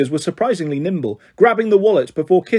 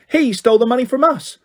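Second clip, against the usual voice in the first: high, indignant accusation; high furious protest; 6 semitones higher than usual. A narrator's reading. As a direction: throw the blame back, furious. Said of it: "Kitty said furiously".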